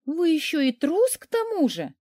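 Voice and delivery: plain reading voice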